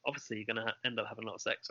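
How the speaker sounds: dropped to a near whisper; hushed. Real, not acted. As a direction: say it under your breath, embarrassed. Rude topic, voice dropped low.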